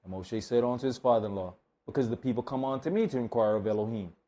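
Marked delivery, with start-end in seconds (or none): none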